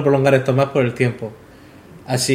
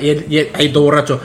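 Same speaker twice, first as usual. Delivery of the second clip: ordinary talk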